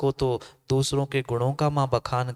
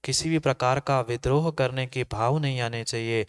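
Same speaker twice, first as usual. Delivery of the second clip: ordinary delivery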